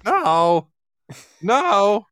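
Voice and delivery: high no with a cracking voice. Pretend. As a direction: let the voice crack on no. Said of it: "my voice cracked again".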